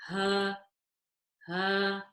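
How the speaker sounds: ha low in the throat with no air; ha said low from the bottom of the throat; deep ha. Shown as a demonstration. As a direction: go deep for ha, no breath on the hand. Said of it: "You need to go lower down".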